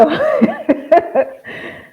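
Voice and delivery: high burst of laughter. Real, not acted. Speaker laughing at herself mid-answer, high laugh.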